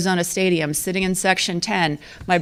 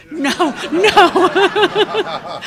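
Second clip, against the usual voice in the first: high, loud, drawn-out no's; comic mock alarm, stretched slowly and pitched way up; husband's alarmed protest; 10 semitones higher, 5 dB louder, much slower than usual. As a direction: imitate a horrified husband objecting. Retold husband overhearing the job offer.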